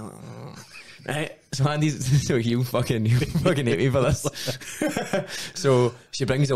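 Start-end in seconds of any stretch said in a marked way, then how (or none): 0.1-0.6 faint groan. Retold or imitated. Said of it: "Andy's lying there".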